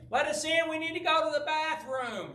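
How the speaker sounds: high-pitched mock clamor; clamoring kids. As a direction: imitate kids hollering through a locked door. Kids clamoring at locked door.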